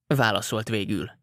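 ordinary delivery, nothing marked

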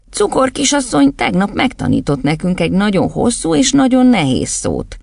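schoolboy, reporting. Acted. Boy on one leg telling the headmistress, lightly childlike. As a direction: pipe up like a pupil. Boy tells of yesterday's lesson.

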